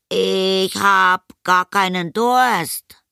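put-on high, very slow stalling boy; boy making excuses. A narrator's reading. Anton puts mother off tea, slow stalling. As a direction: stretch it out while groping for an excuse. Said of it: "sich dabei angestrengt eine Ausrede überlegte".

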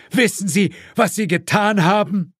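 high-pitched reproach; outraged. A narrator's reading. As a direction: confront him, voice pitched well up. Outraged father confronts the shooter. (empört, hohe Stimme)

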